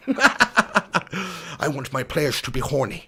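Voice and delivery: put-on slow, slurred generic Dutch accent; Louis Van Gaal. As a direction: put on a hammed-up Dutch football manager's accent. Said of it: "can you please do a Van Gaal accent".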